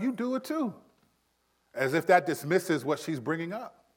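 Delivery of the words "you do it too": defensive whine. Retold or imitated. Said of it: "and I'm like".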